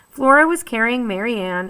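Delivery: ordinary delivery, nothing marked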